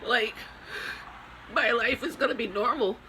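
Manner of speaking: high, tearful voice breaking through sobs; real grief pouring out, voice cracking upward; crying. Real, not acted. Grieving mother breaks down crying.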